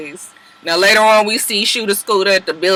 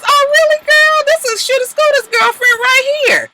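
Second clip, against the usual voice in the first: mock sassy taunt. Keely taunting Sierra about her man, high sassy mock voice. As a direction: throw it at her high and mocking.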